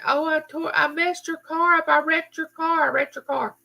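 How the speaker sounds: high, distraught repeating of the apology; upset daughter. Retold or imitated. Daughter apologizing to mom after the crash, high and distressed. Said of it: "she is just crying".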